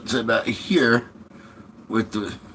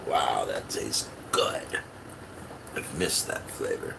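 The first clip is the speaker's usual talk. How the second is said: whispering; 9 semitones lower, 7 dB quieter than usual. Real, not acted. Tasting his drink.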